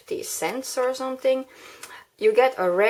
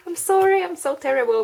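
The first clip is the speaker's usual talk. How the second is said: sudden high, loud little apology; flustered apology; 8 semitones higher, 4 dB louder, faster than usual. Real, not acted. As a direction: blurt it out, caught off guard. Flustered after forgetting the word.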